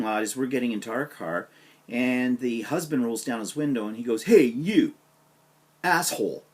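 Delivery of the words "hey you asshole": loud, high yelled insult from a car; mock yell. Husband yelling from his car at the narrator, a loud, high mock yell. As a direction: bark it out the window at him.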